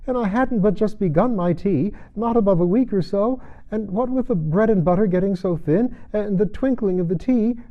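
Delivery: high nervous babble; trembling voice. A narrator's reading. Hatter rambles to the king, high and trembling. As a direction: ramble on in fright. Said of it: "trembling voice".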